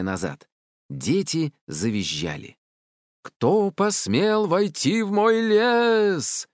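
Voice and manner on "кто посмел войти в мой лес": booming drawn-out high roar. A narrator's reading. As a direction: roar slowly so the whole wood shakes. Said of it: "взревело всё вокруг". (грозный протяжный рёв)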